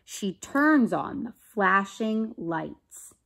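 slow, emphatic storybook narration; read-aloud story. A narrator's reading. Teacher reading to children about a traffic light, deliberate narration. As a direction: draw out key words for young listeners. Reads a picture book aloud.

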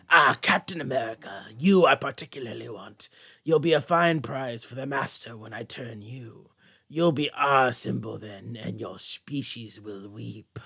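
lightly voiced villain, slowing to a low murmur at the end; a hint of a sinister comic-book baddie; menacing villain voice. Villain taunts Captain America.

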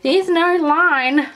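high, loud, drawn-out mock complaint; mimicking doubters. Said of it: "last time people were like".

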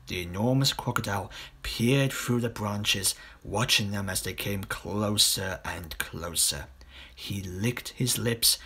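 ordinary delivery

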